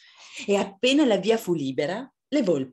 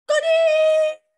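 very high, long shriek; shrieked sighting cry; excited scream; 8 dB louder, slower than usual. Acted. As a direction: squeal it loud and drawn out. Foxes suddenly spot rabbits.

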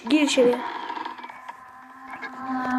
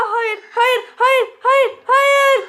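panicked screaming; 9 semitones higher, much slower than usual. Real, not acted. Streamer caught by the game monster, panicked screaming. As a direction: scream for your life as it grabs you.

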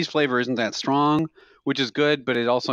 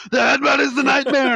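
much higher and louder hammed-up rant; mock cabbie yell; 12 semitones higher, 6 dB louder than usual. Cabbie shouting back at passengers, mock yell. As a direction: shout it like the crazed driver. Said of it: "just screaming".

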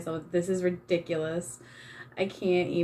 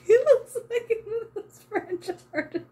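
much higher, breaking into laughter; laughing; 13 semitones higher than usual. Real, not acted. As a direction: giggle through the words. Laughing at the face she painted.

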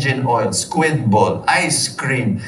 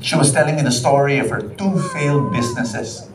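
ordinary talk, nothing marked

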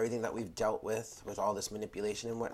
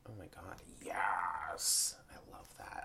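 soft whisper; 3 semitones lower, 6 dB quieter, slower than usual. Real, not acted. Hushed delight at the card.

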